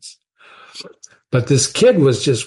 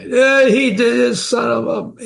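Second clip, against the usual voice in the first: high, sputtering imitation of a furious boss; mock swearing rage; 11 semitones higher than usual. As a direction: mimic the young president cursing in a rage. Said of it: "he's swearing left and right".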